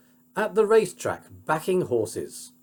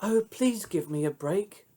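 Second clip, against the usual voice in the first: mild pleading; 6 semitones higher, 3 dB quieter than usual. A narrator's reading. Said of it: "She cried".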